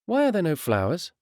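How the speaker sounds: put-on a young girl wondering at the grave; child's question. A narrator's reading. Young sister at her father's grave asking innocently. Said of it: "asked Shona".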